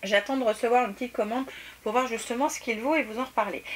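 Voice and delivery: usual speaking voice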